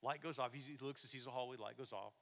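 ordinary talk

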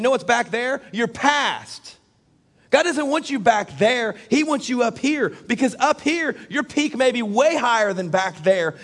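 high, insistent preacher's exhortation; forceful preaching. Acted. Preacher urging the congregation away from their past, forceful preaching. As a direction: urge them upward with a lifted, punchy voice.

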